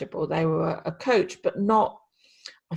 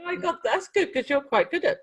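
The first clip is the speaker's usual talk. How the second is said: laughing; 11 semitones higher than usual. Real, not acted. Laughing reply to joke.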